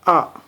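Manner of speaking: "ah" struck high, then dropped fast; sharp fourth tone. Shown as a demonstration. Teacher repeating the sharp fourth tone for learners. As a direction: cut "ah" short with a steep fall. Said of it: "This kinda sharp".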